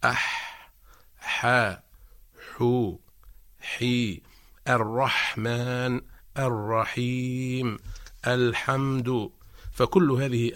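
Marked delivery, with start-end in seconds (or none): 0.0-9.3 ح breathed from mid-throat, slow; slow drill on ح syllables; clear ha drill. Shown as a demonstration. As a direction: stretch الرحمن with an airy ح. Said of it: "نقول مثلًا".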